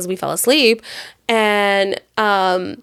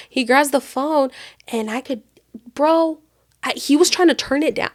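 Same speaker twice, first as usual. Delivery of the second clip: normal talking voice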